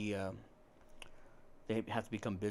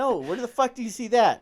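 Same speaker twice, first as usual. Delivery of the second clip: high, loud exasperated snap; fed-up shout at the teasing; exasperated outburst; 12 semitones higher, 11 dB louder than usual. Retold or imitated.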